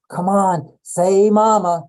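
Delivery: put-on pleading with baby to say mama, loud and eager; eager coaxing parent. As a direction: urge the baby on, drawn out.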